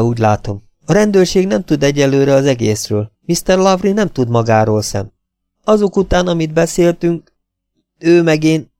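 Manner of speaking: ordinary delivery, nothing marked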